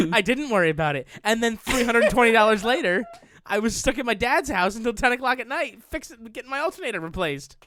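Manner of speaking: loud, high, half-laughing rant; real exasperation. Real, not acted. Car owner to co-host after bad advice, exasperated. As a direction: vent it loudly through a laugh.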